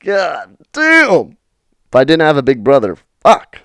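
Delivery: high, loud relieved exclamation; stunned relief. Retold or imitated. Kid saved by his brother, loud stunned relief. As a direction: blurt it out like you just escaped.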